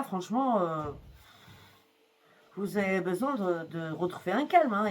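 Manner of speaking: normal talking voice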